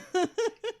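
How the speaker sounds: rising squeal of laughter; high giggle. Real, not acted. Laughing with cohost.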